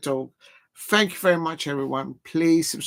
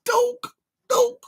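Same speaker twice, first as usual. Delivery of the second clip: squeaky high dog; 3 dB louder than usual. Shown as a demonstration. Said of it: "I like the dog better".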